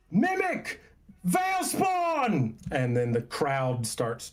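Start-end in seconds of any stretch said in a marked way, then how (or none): 0.1-2.5 high, alarmed shout from across the square; loud call. Acted. Villager shouting an alarm at the crowd, high and loud. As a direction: cry out a warning to the whole market. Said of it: "loud call from off to the left".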